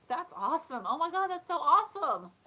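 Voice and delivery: lightly hammed-up delight; gushing compliment. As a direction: play up an admiring compliment. Imitating own gushing compliments.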